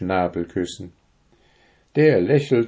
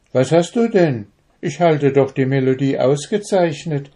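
puzzled; 5 semitones higher, 4 dB louder than usual. A narrator's reading. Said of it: "voller Unverständnis".

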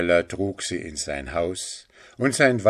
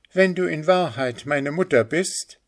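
put-on high, cold boyish rebuke; hard and unfriendly; 8 semitones higher than usual. A narrator's reading. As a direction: reject the mother harshly. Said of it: "seine Stimme war hart und unfreundlich". (hart und unfreundlich)

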